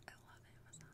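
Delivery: breathless whispered confession. Real, not acted. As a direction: whisper it like a guilty secret. Gushing aside about Amazon.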